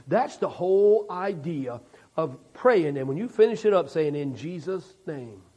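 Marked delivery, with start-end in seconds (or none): 4.2-5.4 low, soft, reverent close; closing prayer. Acted. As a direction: drop low and hushed for the amen line.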